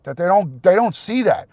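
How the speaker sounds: loud, high, furious rant; real anger. Real, not acted. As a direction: shout it at the people who won't listen. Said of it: "I'm fucking pissed".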